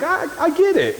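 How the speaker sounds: exasperated agreement. Retold or imitated. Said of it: "And now I'm like".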